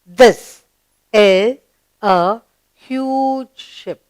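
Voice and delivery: drawn-out classroom reading; read-along model. Shown as a demonstration. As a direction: lead the class with a pause after each word. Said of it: "Let's read the sentence together".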